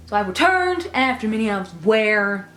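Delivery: slow, loud airy socialite voice; socialite Sandy voice. Pretend. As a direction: gush like a glam socialite. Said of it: "in my socialite Sandy voice".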